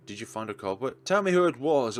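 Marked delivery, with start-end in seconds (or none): 1.1-2.0 demanding. Acted. Demanding revenge on the culprit.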